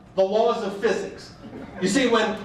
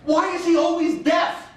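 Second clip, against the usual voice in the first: exasperated shout; 9 semitones higher, slower than usual. Real, not acted. Exasperated comic outburst.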